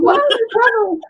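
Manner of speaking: put-on very high kid voice through laughter; protesting kid self. Child defends the show to mom, high-pitched laughing protest. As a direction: squeal it like a baffled child, laughing. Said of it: "I'm like".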